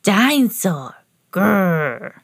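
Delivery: little pig growling like a toy dinosaur; put-on toddler voice with a growl; toddler George. A narrator's reading. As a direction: say it as a tiny dinosaur fan, rolling into a growl. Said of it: "he could say one word very well".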